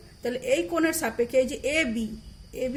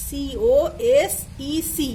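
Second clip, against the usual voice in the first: সিওএসইসি spelled letter by letter, very slowly; teacher spelling সিওএসইসি slowly; 3 dB louder, much slower than usual. Shown as a demonstration. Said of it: "সংক্ষেপে লিখব cosec".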